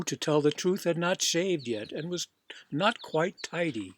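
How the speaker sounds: ordinary delivery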